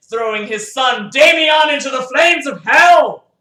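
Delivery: booming narrator; thundering storyteller; loud climactic narration. A narrator's reading. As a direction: bellow the climax of the scene.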